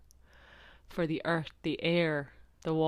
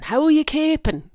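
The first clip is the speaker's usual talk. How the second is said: much higher, louder, quicker thick Kilkenny country accent; 11 semitones higher, 10 dB louder, faster than usual. Shown as a demonstration. Host hamming up a thick Kilkenny country accent for listeners. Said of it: "thick country accent".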